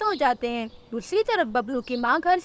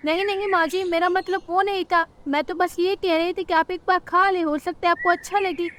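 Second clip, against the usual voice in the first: higher-pitched placating bird wife; pleading daughter-in-law; 6 semitones higher than usual. Acted. Rano asks mother-in-law to taste it, pleading.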